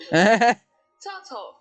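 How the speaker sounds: turtle in a loud British accent. Shown as a demonstration. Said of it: "British accent works".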